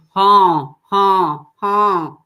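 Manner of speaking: loud nasal honks, constricted; honk exercise. Shown as a demonstration. Coach leading a constricted honk drill, loud. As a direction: honk like a goose, tight throat.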